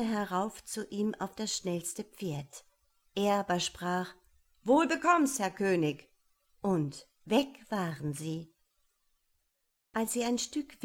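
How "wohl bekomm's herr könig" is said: höher und lauter, spöttisch zum König; spöttischer Gruß. Acted. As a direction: ein keckes Lebewohl hinüberrufen. Höhnischer Abschiedsgruß einer Figur. (spöttisch und laut verabschieden)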